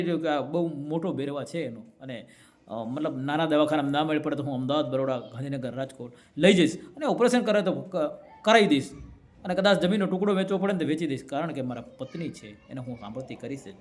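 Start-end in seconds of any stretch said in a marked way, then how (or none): none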